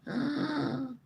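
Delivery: sharp breathy gasp of wonder at first snow; retold gasp. Retelling her first sight of snow, a wondering gasp. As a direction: gasp as if seeing snow fall for the first time. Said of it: "I was looking up going".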